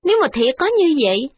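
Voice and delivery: put-on a frowning old lady brushing off the complaint; frowning old mother. Mother-in-law answering her son's wife, frowning and fretful.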